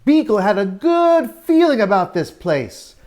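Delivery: plain reading voice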